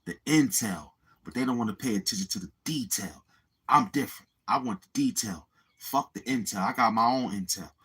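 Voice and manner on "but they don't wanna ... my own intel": ordinary talk, nothing marked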